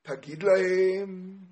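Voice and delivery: slow, stretched recitation in God's words; sing-song preaching. Retold or imitated. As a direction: intone it slowly, drawing out phrase endings. (דרשה בניגון איטי)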